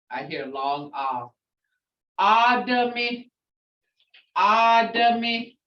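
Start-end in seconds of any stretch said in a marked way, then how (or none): 2.2-5.6 Adami slow and louder, ah then uh. Shown as a demonstration. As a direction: draw out Adami syllable by syllable. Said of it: "I hear a ah and a uh".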